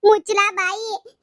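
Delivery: high, loud, slow scolding; hammed-up shut your mouth; mock angry shout. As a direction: snap at the son in staged fury. Staged scolding of son.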